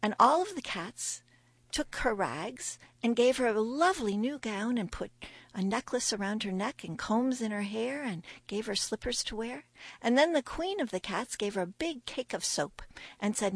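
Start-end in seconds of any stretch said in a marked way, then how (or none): none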